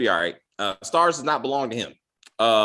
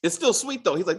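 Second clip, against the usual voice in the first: ordinary talk, nothing marked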